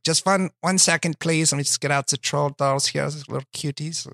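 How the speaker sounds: high mock Hitler fussing over his dolls; lightly voiced Hitler cooing over troll dolls. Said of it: "Adolf Hitler if he was into troll dolls".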